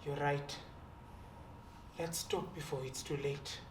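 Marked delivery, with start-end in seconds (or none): 2.0-3.6 hushed warning. Acted. Quiet warning in tense standoff.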